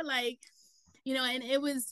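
normal talking voice

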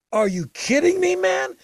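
high-pitched are-you-kidding-me excitement; excited disbelief. Retold or imitated. Excited recalling Motorhead offer.

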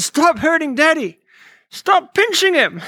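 imitating a small girl's high-pitched plea; playful high child voice, slowing at the end; little daughter. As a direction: pitch it up like a child telling Mom off. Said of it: "my little daughter".

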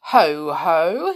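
loud, drawn-out wizard's catchphrase; pompous wizard. A narrator's reading. Wizard comes near the pixies, booming grandly. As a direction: boom it out like a gloating wizard.

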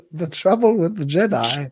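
high, mock-grand king voice; pompous king voice. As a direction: play a haughty king. Imitating Longshanks' line.

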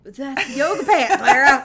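loud, laughing protest; mock exasperation. Real, not acted. Correcting a co-host about yoga pants, loud mock exasperation. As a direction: shout it in playful exasperation.

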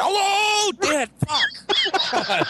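high shouting dad imitation; mock shouted hello. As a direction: blast hello down the line. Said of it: "answers the phone screaming".

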